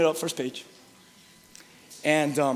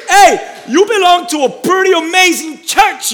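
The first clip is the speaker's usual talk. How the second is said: shouted prompt; 15 dB louder than usual. Pretend. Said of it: "Shake someone next to you and say".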